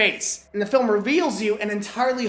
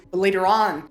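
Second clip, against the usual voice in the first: ordinary talk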